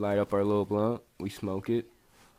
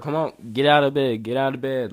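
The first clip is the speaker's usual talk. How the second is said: raised-voice command, easing on the repeat; retold police order; 3 semitones higher, 5 dB louder than usual. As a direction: order someone up like a cop. Police roust him from bed.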